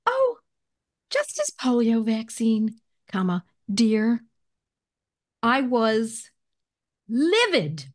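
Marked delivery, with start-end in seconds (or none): 0.0-4.2 mock the nurse's sugary tune; sing-songy nurse. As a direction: nurse brushing off the mother, sing-song. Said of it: "sing-songy".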